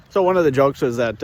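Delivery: ordinary talk, nothing marked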